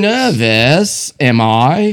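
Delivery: put-on jokey British accent about being nervous; mock British accent. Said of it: "I'm speaking with a British accent 'cause I'm nervous".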